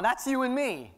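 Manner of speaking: usual speaking voice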